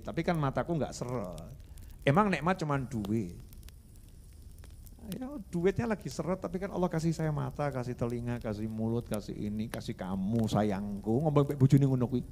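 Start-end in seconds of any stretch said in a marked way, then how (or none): 5.1-11.3 soft, settling lower as he counts blessings; acted sweet talk, low and intimate; tender husband. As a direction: reassure your wife gently. Voicing husband talking tenderly to wife.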